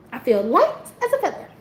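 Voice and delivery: light, high laugh of relief. Real, not acted. Telling viewers how light she feels, laughing. Joyful relief after moving on.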